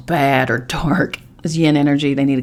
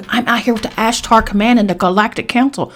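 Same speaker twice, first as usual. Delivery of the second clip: mocking spiritual type; 5 semitones higher than usual.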